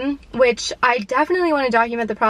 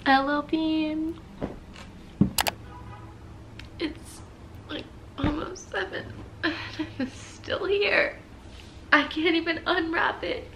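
choked, crying voice, barely holding it; 4 semitones higher, 8 dB quieter than usual. Real, not acted. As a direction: speak through real tears, softly. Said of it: "I'm gonna cry again".

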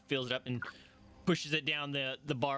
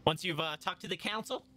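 higher, excited dwarf innkeeper voice; 7 semitones higher than usual. Acted. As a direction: tell them eagerly as the barkeep.

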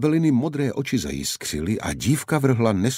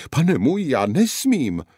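higher, a touch louder lament; wailing; 8 semitones higher, 4 dB louder than usual. A narrator's reading. The guard wails to the knight, lamenting. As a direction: plead that you must not. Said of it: "hořekoval Maligu".

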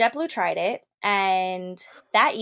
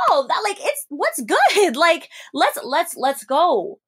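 high, slow, drawn-out provocation; hyped high challenge, drawn out; ready to fight; 6 semitones higher, 3 dB louder, slower than usual. Retold or imitated. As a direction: taunt her up high and slow. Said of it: "And I'm like".

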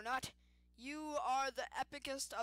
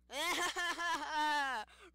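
high, loud laugh bursting out; burst of laughter; 5 semitones higher, 9 dB louder than usual. Real, not acted. Laughing loudly at the candy bell.

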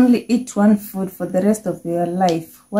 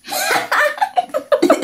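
high evil laugh; evil cackle. Pretend. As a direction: cackle like a villain. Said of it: "Do your best evil laugh as loud as you can".

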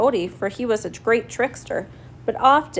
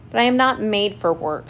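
plain reading voice

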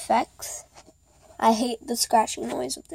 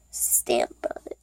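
unvoiced whispered aside; whispering; 13 semitones lower, 6 dB quieter than usual. Real, not acted. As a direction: drop to a breathy hush. Trails into an aside.

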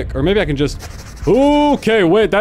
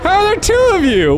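shocked disbelief; 12 semitones higher than usual. Real, not acted. Player spots a second killer Santa, stunned high outcry. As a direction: cry it out in real disbelief.

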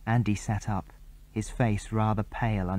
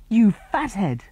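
put-on high, louder girlish scold; 10 semitones higher, 6 dB louder, slower than usual. A narrator's reading. Jill to Tom, high scolding girl. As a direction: tell him off.

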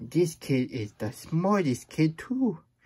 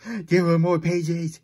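louder, slower, stress on There; dramatic dismay; 4 semitones higher, 7 dB louder than usual. Acted. Reader protesting more pages, loud and slow. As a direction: proclaim it like a tragedy. Repeats line differently.

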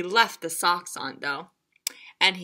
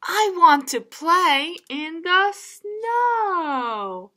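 lightly voiced, slow and higher; a child pleading to play; a hint of an excited little frog; eager Froggy; 8 semitones higher, 4 dB louder, much slower than usual.

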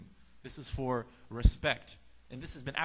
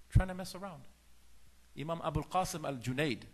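ordinary talk

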